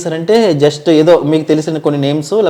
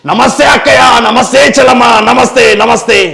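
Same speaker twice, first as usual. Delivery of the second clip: politician greeting; 6 semitones higher, 6 dB louder than usual. Retold or imitated.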